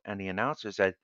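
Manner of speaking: usual speaking voice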